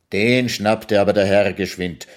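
ordinary delivery, nothing marked

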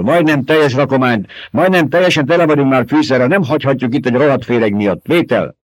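a crew chief refusing to leave his cargo; booming radio voice. A narrator's reading. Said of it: "egy hang dörgött".

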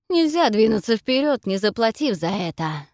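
loud, defiant challenge. A narrator's reading. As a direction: throw it back as a challenge. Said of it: "с вызовом произносит она".

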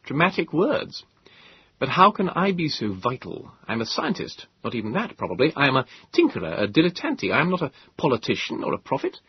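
a touch of wry modesty; young Moses. Acted. Moses voiced by narrator.

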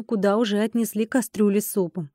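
usual speaking voice

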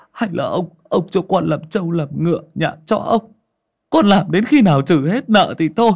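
high, strained begging voice; a broken peasant begging for his mother's land; pleading desperately. Acted. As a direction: beg for mercy, near tears. Poor man begs the moneylender.

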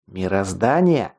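slow, incredulous echo in restrained anger; angry cry. A narrator's reading. Teacher challenging the guard's excuse, restrained angry cry. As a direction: throw the word back angrily, drawn out, no shout. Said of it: "зло крикнул Скелл".

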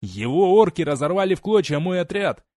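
desperate shout. Acted. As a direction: scream the truth from the gallows. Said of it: "он закричал".

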